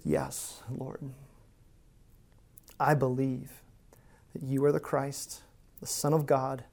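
soft, halting reply with pauses; subdued, reverent profession of faith; hushed, humble confession. Acted. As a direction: answer quietly, as if head bowed. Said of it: "I imagine her with her head".

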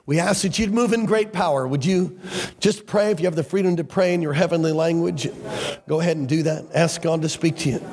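rising, pleading prayer voice; fervent prayer. Acted. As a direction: pray it out with growing fervour.

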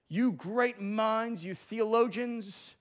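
slow, sarcastic stretch of each word; drawn-out mockery. Professor mocking students, slow sarcasm. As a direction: savor the sarcasm slowly.

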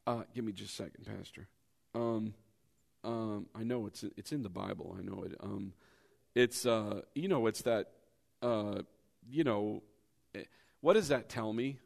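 low, soft, fumbling stall; mumbling unsure churchgoer. Retold or imitated. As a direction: mimic someone searching their memory. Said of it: "when everybody goes".

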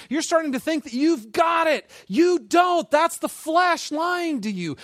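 high, loud, impassioned pleading; God confronting a believer. Acted. Preacher voicing God warning a proud believer, impassioned. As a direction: drive it home, building to a shout. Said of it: "And he's like".

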